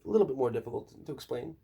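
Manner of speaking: ordinary talk, nothing marked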